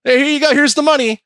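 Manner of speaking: high, upbeat mock dealmaker; cheerful buyers. Buyers handing over the money, high and cheerful. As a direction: close the deal brightly and high. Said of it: "They're like".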